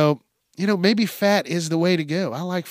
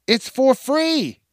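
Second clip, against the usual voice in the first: emphatic; 10 semitones higher, slower than usual. Real, not acted. Ranting about the halftime complaints.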